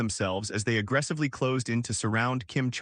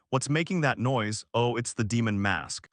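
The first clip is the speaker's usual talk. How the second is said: ordinary delivery, nothing marked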